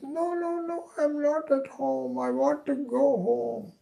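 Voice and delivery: very high, whining voice of the drugged son; delirious protest. Retold or imitated. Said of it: "He says".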